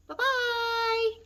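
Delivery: very high squeaky little bear goodbye. Acted. Baby Bear calls goodbye to the girl, high and squeaky. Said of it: "a high, squeaky voice".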